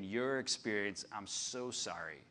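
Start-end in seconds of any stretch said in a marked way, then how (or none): 1.1-2.2 soft, low, sincere apology. Real, not acted. Pastor apologising to church-hurt people, soft sincere tone. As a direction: say sorry gently to the hurt. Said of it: "to say to you".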